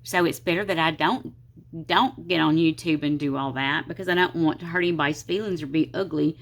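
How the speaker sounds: usual speaking voice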